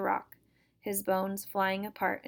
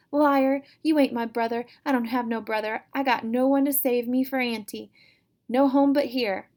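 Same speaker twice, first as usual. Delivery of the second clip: louder, higher furious rejection; angry denial; 4 semitones higher, 8 dB louder than usual. Acted. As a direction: spit back at him, refusing to leave. Sister rejects her brother.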